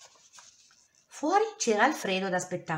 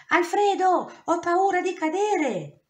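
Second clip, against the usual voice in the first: high, louder, slow cry of fear; cling on and call for help; wolf stuck atop the tree, terrified; 12 semitones higher, 6 dB louder, slower than usual. A narrator's reading. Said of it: "era terrorizzato".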